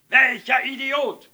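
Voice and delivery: high, very loud, drawn-out roar; enraged, stretched shout; furious bellow. A narrator's reading. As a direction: roar it into the receiver. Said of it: "brüllte er in die Muschel".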